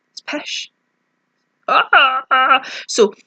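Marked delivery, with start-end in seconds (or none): 0.1-0.7 whispered "pish", low and soft; a hushed Scottish "pish"; whispered Scots word. Real, not acted. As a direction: breathe "pish" like a secret. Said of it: "good Scottish words".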